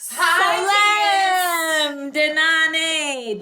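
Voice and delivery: excited greeting. Acted. Greeting family loudly on arrival.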